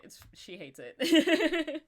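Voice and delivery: laughing, slow and loud. Real, not acted. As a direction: laugh through the words. Laughing while telling.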